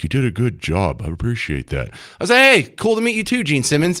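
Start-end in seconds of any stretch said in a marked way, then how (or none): 0.0-1.9 low, soft celebrity impression. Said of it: "that's how he talks". 2.4-4.0 high, chirpy starstruck reply; eager greeting. Retold or imitated. Acts own eager reply to star.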